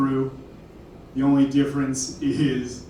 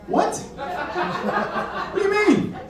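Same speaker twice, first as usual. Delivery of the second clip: high disbelieving laugh-question; much slower than usual. Real, not acted. Reacting to audience.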